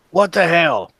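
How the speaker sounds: a mayor caught off guard, barking the question; stunned mayor yelling at the intruders; indignant surprise. Acted.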